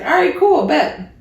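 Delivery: high, bright quick agreement; mock eager reply. To the guy offering to drive over, mock eager. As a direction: pitch up like a casual yes.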